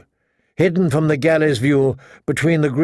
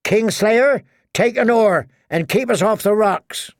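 raised-pitch brisk command; 7 semitones higher than usual. A narrator's reading. Woman ordering Kingslayer to the oar, commanding. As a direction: order the prisoner to work, voice lifted. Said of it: "the wench commanded".

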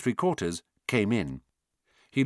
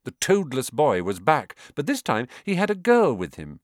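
ordinary delivery, nothing marked

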